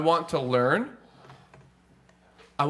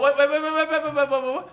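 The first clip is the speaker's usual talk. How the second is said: frantic interrupting; 8 semitones higher, faster than usual. Real, not acted.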